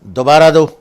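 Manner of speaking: sharp, loud mother's command; loud scolding mother; stern loud order. Retold or imitated. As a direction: bark the order like a strict mother. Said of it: "انہوں نے بڑے زور سے کہا".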